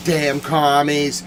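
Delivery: ordinary talk, nothing marked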